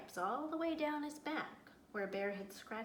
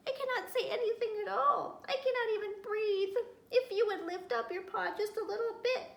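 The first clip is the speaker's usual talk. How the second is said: squirrel voice; 10 semitones higher, 5 dB louder than usual. A narrator's reading. Squirrel pleading under bear's paw.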